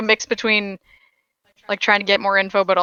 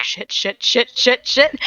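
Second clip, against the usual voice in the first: mock panic; 4 semitones higher, 3 dB louder, slower than usual. Plume imagined panicking at the news.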